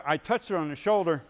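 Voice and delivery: normal talking voice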